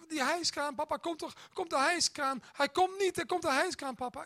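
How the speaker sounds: put-on high, frightened toddler crying out for papa; little son waking dad, afraid of the crane; scared little boy. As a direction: play the panicked three-year-old in the night. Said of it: "dan schreeuwt hij".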